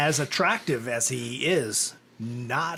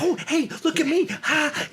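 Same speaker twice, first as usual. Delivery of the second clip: put-on high, excited little-kid voice; Stuart; 13 semitones higher than usual. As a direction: squeal for attention like an overexcited child. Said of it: "like Stuart from Mad T V's".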